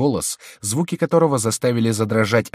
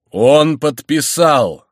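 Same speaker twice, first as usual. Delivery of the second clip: high, loud, very drawn-out announcement; exclaimed; 7 semitones higher, 9 dB louder, much slower than usual. A narrator's reading. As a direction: cry it out, stretching the words. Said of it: "вскричал Горанфло". (громкий протяжный возглас)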